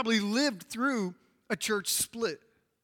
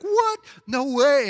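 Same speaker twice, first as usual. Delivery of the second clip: high, loud yelp of disbelief; shocked refusal; 6 semitones higher, 8 dB louder, slower than usual. Acted. Preacher plays Greek converts hearing about circumcision, shocked. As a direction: balk loudly, pitch shooting up.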